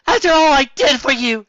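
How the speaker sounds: high, loud, hammed-up tearful cry; guilt-tripping mother wailing at her son. A narrator's reading. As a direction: overplay the wounded mother. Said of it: "she said tearfully".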